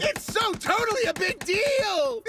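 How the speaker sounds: high, loud and stretched out; excited. Acted. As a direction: gush with excitement. Excited reply to calm friend.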